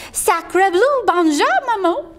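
put-on high French baby voice; baby duck. Duckling hatches and sees Jack, high baby greeting.

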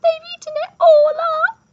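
very high, loud squealed complaint; squeaky baby bear. A narrator's reading. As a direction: wail it in a tiny cub's squeak. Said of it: "squeaked Baby Bear".

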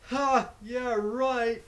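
a snide aside he hopes the boss misses; sarcastic mutter. Real, not acted.

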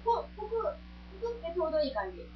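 talking through a mouthful; chewing while speaking; mouth full. Real, not acted. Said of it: "めっちゃ口に突っ込んで食べよるけど".

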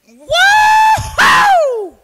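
very high, loud "woo-hoo" scream; cheering whoop. Retold or imitated. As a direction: whoop wildly like a fan at a win. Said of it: "I was cheering, and I said".

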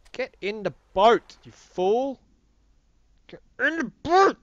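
loud, high-pitched bellow; mock shouted order. Gamer ordering a fool into the boat, mock shouting.

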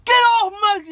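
high, loud furious tirade; drill sergeant yelling. Retold or imitated. Drill sergeants berating recruit who went left, furious yelling. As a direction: scream down at the private who turned wrong. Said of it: "they just yelling at me".

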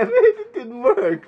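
high laughing whine; laughing disappointment. Real, not acted. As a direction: giggle out the failure. Failed scare, laughing.

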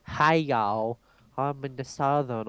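put-on Southern drawl; Southern accent. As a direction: lay on a playful Southern accent. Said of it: "I could go all Southern".

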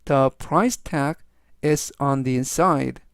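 very slow, each word separated; slow, clear reading. Shown as a demonstration. Teacher slowly reads the sentence three times for students. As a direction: read slowly so students can follow. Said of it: "제가 역시 천천히 세 번씩 읽어드리고요".

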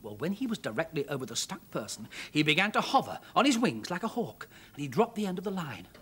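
plain reading voice

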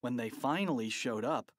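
usual speaking voice

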